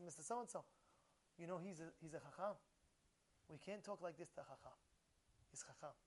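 very soft, low, gentle plea; hushed polite tone, retelling a tactful reproof; nice voice. Retold or imitated. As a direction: quietly reason with them, respectfully. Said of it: "in a nice voice, in a nice tone".

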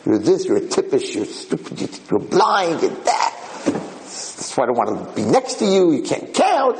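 put-on squabbling voice, jumping up high; mock name-calling. Old porch-sitters calling each other names, mock squabbling. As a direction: hurl imitated insults, ending on a high cry. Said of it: "they're yelling and calling each other names".

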